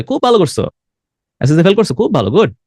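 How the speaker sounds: high-pitched mock approval from a displeased father; sarcastic praise. Father answering a child who failed SSC, sarcastic praise. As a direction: praise it so the intonation means the opposite. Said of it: "ইন্টোনেশনে বোঝা যাচ্ছে যে এটা ওই গুড না".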